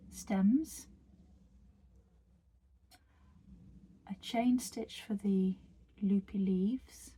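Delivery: a calm whisper guiding the stitches. Acted. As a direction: murmur each step gently, like close-up ASMR. Whispered tutorial walkthrough.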